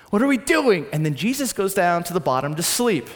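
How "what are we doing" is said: higher and louder alarmed cry; louder worried cry. Retold or imitated. Pastor voicing disciples in the boat, crying out in alarm. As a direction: let the worry burst out.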